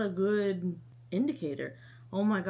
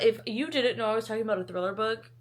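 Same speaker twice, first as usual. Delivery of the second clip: usual speaking voice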